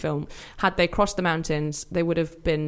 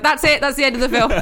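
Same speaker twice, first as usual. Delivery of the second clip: higher, louder brisk mother voice; mother imitation; 8 semitones higher, 8 dB louder than usual. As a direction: cut the film short like a brisk mum. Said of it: "my mother used to be like".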